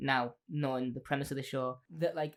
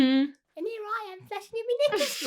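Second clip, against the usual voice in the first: mock Jackie; 4 dB louder than usual. Host quoting Jackie's flirty line to co-host, high sing-song.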